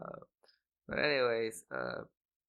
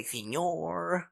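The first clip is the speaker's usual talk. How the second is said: a faked Spanish accent on "Señor", a bit higher; 6 semitones higher than usual. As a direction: say "Señor" like a put-on Spanish speaker. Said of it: "it sounds like I'm faking it".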